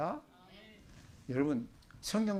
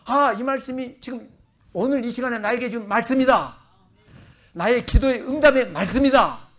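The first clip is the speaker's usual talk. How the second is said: loud, high burst of being moved; deeply moved exclamation; 8 semitones higher, 13 dB louder than usual. Acted. Believer reading scripture feels God speaking personally, loud moved exclamation. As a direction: exclaim it like a sudden personal revelation, building louder. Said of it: "감동이 될 때가 있어요".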